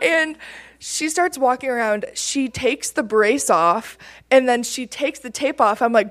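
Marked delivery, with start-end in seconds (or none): none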